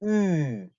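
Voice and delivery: "으" as ee voiced through oo-rounded lips; ü sound. Shown as a demonstration. Teacher explaining lip shape to learners, long rounded ü. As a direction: hold "으" as a long German ü. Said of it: "우 한 상태에서 소리만 이를 내니까".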